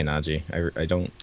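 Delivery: normal talking voice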